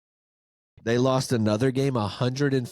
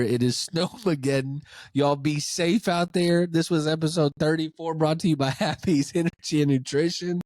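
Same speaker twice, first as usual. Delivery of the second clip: ordinary talk, nothing marked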